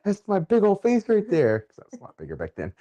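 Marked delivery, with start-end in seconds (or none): 0.0-1.6 high-pitched, giddy mock excitement; excited recognition. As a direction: pipe up high, pointing yourself out. Acted excitement seeing own face.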